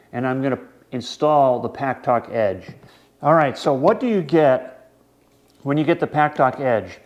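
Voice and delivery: usual speaking voice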